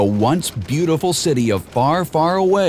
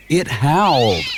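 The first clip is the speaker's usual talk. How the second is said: plain reading voice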